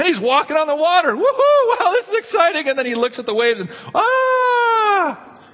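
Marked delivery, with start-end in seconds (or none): 1.2-2.7 high, whooping mock excitement; hammed-up giddy delight; thrilled Peter. Acting Peter thrilled on the water. 3.9-5.4 long, high cartoonish scream; hammed-up "aaah" of sudden panic; mock terrified scream. Peter sees the waves, acted scream.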